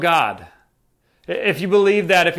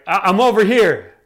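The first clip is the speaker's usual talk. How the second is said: louder call to someone across the way; acted "over here" shout, mildly raised; 3 semitones higher, 6 dB louder than usual. Paul answering the man looking for him, calling out.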